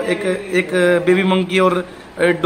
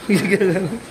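ordinary talk, nothing marked

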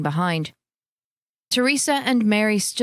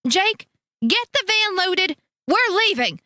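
much higher, clipped order; sharp retort; 11 semitones higher than usual. A narrator's reading. Teresa ordering her husband, sharp retort. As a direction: snap the order at him. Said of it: "Teresa shot back".

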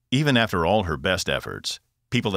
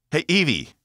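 calling out; 11 semitones higher, 5 dB louder than usual. A narrator's reading. Shaking her out of trance.

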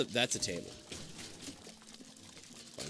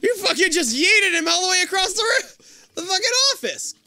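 very high, loud outburst, laugh-cry in it; incredulous screech; 9 dB louder than usual. Real, not acted. Player to Tyler who threw the figure, incredulous screech. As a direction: screech in disbelief. Reacts to figure thrown away.

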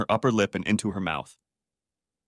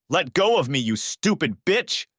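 angry yell; 6 semitones higher than usual. A narrator's reading. Darren yelling at Shannon as he shoves her, high and slow. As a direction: spit it at her in a rage. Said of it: "yelled Darren".

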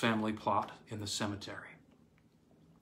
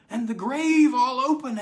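high and much louder, crying out; panicked cry; 13 semitones higher, 9 dB louder than usual. Acted. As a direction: burst out in horror. Old man's outburst.

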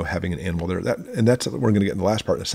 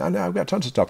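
mock boast, pitched much higher; hammed-up, higher brag about having plenty; smug prepper; 10 semitones higher than usual. Mocking a prepper who shrugs off the danger, pitched higher.

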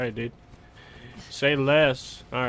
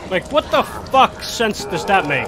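furious, much louder and higher gamer rant; real angry yelling; 7 semitones higher, 5 dB louder than usual. Real, not acted. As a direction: blow up at the game, yelling. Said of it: "I'm getting mad".

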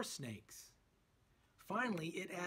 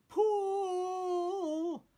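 much higher and louder yelled cry; 9 dB louder than usual. A narrator's reading. Scooby and Shaggy signal the trap, loud high cry. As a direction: haul on it with a shout. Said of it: "they cried".

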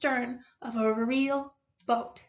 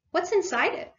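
higher, keenly curious; eager to find out; eager question; 6 semitones higher than usual. A narrator's reading. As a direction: ask with mild eagerness. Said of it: "asked the Mole eagerly".

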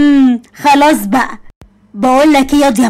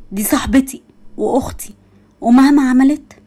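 ordinary talk

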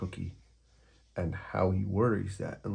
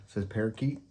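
usual speaking voice

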